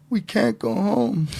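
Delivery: high, slow whiny wail; drawn-out, high-pitched fake sob; mock crying. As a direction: imitate a tearful guest pleading to stay. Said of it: "they start crying".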